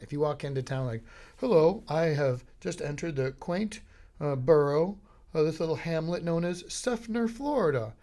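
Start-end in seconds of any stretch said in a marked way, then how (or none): 1.4-7.9 higher, mannered, over-formal newcomer; refined visitor. Retold or imitated. Visitor walking into town, mannered formal greeting. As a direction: announce yourself like a precious outsider. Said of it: "you can't say it like that".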